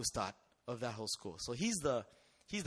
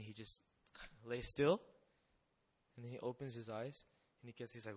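normal talking voice

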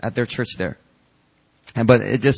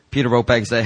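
ordinary talk, nothing marked

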